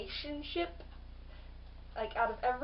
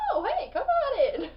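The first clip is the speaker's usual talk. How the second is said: bright, higher, louder greeting with a laugh; cheery welcome; 8 semitones higher, 5 dB louder than usual. Retold or imitated. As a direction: wave them in happily, laughing. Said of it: "He's like".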